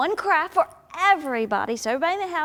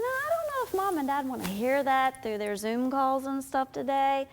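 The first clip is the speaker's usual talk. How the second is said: ordinary delivery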